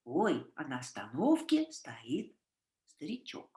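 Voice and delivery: "Ой" opening, "старичок" fading very soft; сказочная интонация; storytelling tone. Shown as a demonstration. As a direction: tell it like a children's tale. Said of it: "How do you say that in English?".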